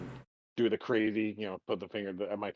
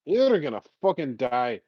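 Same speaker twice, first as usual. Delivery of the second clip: mock shouted warning; 4 semitones higher, 8 dB louder, slower than usual. Repeats warning to Neo.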